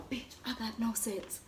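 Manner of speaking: hushed, breathy whisper. Real, not acted. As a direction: whisper it to yourself. Whisper then repeated aloud.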